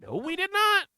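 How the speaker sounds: real indignant shout; loud denial. Real, not acted. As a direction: yell down the fond memory. Streamer rejects his own comment.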